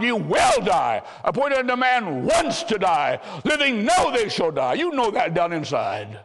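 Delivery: fervent pulpit warning, pitched high; urgent preaching. Real, not acted. Preacher urgently warns of death.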